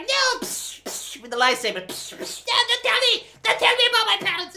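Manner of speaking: high, loud whiny parody with lightsaber noises; mock Rey. Mocking Rey swatting Kylo away, high whiny voice. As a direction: whine it like a brat swinging a saber.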